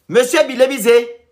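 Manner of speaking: loud, high, drawn-out summons; calling out. Acted. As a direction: call the candidate out by name. Calling out candidates by name.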